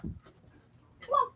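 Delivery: hushed, high call to the cat; soft high coax. Real, not acted. Owner softly coaxing her cat, high and hushed.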